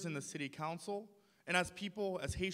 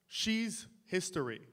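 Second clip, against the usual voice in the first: ordinary delivery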